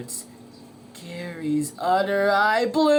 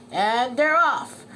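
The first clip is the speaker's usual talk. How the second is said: louder announcer call; race announcer; 6 semitones higher, 6 dB louder than usual. A narrator's reading. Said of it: "said the announcer".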